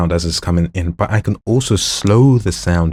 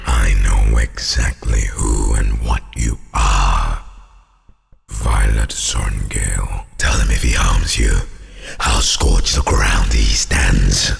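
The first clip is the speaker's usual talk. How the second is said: very low, loud, slow monster voice; diabolical growl; 10 semitones lower, 4 dB louder, slower than usual. Acted. Threatening whoever harms Violet Sorngale, diabolical deep growl. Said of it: "That was diabolical".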